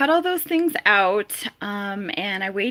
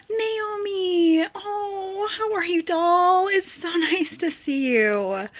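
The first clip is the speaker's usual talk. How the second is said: high squeal, then melting into a laugh; delighted greeting; 9 semitones higher, slower than usual. Real, not acted. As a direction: cry out her name in joy.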